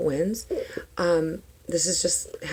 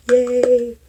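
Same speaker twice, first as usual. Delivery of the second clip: excited cheer; 6 semitones higher, 5 dB louder than usual. Real, not acted. Cheering after welcoming viewers.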